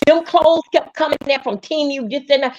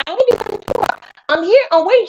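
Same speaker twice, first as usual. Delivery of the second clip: raised pitch, mock complaining woman; imitating Teresa; 8 semitones higher, faster than usual. A friend quoting Teresa's whining complaint. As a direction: voice Teresa pleading her case.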